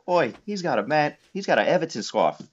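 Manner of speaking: imitating a local fan calling out to his buddy; mock Manc fan. Fan to his mate about my scarf, mock Manchester accent.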